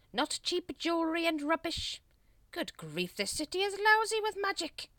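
high, loud scolding; pompous leader losing patience; exasperated Grand Master. A narrator's reading. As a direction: berate useless followers. Grand Master berates brethren.

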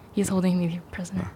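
unvoiced joking whisper. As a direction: whisper it conspiratorially. Whispered joke aside.

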